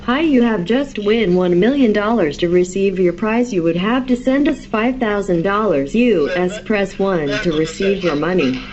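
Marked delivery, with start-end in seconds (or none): none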